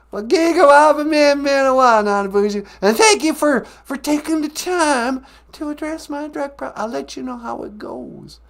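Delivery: very high, loud puppet-like voice; high-voiced character. Character thanking the host for taking the time, high voice. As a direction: pipe up in a squeaky-high earnest voice.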